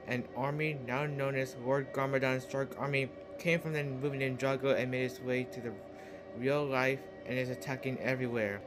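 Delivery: ordinary talk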